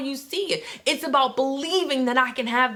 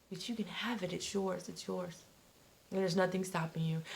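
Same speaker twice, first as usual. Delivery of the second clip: much softer, lower, intimate; soft reassurance; 5 semitones lower, 14 dB quieter than usual. Acted. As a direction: reassure gently, almost confiding. Soft reassurance after quote.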